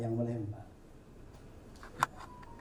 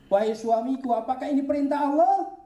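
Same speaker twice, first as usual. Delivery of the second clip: pleading wife; 10 semitones higher, 4 dB louder than usual. Acted. Wife Hajar asks her husband.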